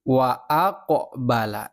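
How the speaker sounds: "wa aqbala" slow, the q bouncing with a light echo vowel; bouncing qaf. Shown as a demonstration. Teacher shows pupils the bouncing qaf, slow and clear. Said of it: "kalau qafnya ini memantul".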